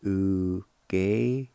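"Ugeitin" drawn out very slowly, syllable by syllable; slow demo. Shown as a demonstration. Teacher showing the second way of "Ugeitin", slowly. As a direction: stretch "Ugeitin" right out. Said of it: "Or like this".

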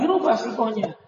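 normal talking voice

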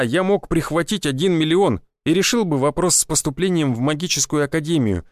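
ordinary delivery

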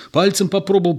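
hurriedly explain, a bit at a loss; flustered, rapid excuse; bewildered excuse. A narrator's reading. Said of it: "растерянно говорил Егор".

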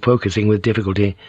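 plain reading voice

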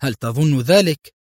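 lightly mocking question; ironic, teasing challenge; sarcastic. A narrator's reading. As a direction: question it with a hint of scorn. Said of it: "أجابه أدهم متهكما".

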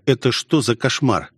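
ordinary delivery

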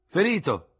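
officer urging the doctor to go at once; urgent lieutenant. Acted. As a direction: push him to hurry. Lieutenant answers.

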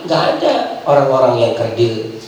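ordinary talk

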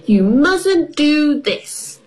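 put-on slow, high bossy little girl; big sister bossing George, a bossy little voice. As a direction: boss her brother about slowly. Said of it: "in a bossy voice".

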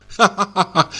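ha-ha-ha of scornful disbelief; mock laugh. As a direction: laugh him off with contempt. Mocking laugh at confession.

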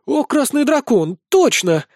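high start, sudden recognition; exclaiming. A narrator's reading. Succubus recognising the famous dancer, exclaiming. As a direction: exclaim as you suddenly place him. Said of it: "воскликнула ещё одна".

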